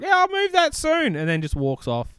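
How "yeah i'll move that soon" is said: high airy brush-off; casual mock Vito voice, lifted high; Vito's breezy brush-off. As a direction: toss it off breezily, pitched way up. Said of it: "And Vito's like".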